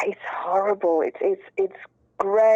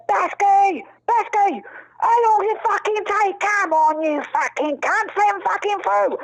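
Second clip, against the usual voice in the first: bellowing shout; 12 semitones higher, slower than usual. Retold or imitated. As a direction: holler impatiently at the friend. Charlie bellowing at Basky.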